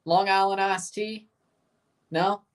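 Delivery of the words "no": usual speaking voice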